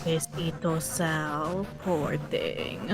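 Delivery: low, soft pitying murmur; pitying coo. Real, not acted. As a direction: coo sympathy at a hurt friend. Pitying friend's dog bite.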